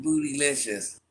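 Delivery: ordinary talk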